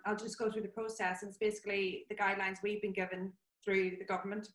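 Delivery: ordinary talk, nothing marked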